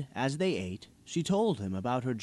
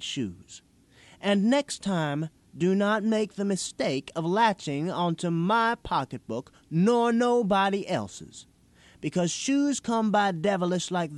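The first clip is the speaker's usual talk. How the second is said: put-on higher, louder, scolding a young thief; stern older woman; 6 semitones higher, 4 dB louder than usual. A narrator's reading. Woman warns the boy who stole her purse, stern and loud. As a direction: lay down the law like a firm mother.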